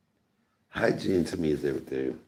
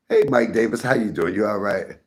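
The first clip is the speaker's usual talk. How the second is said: ordinary talk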